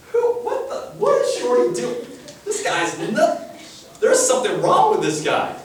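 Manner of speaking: loud, high jeering with laughter; mocking crowd. Crowd jeers at Zacchaeus climbing, loud and laughing. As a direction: laugh at the little man up the tree. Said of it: "You're just making fun of him".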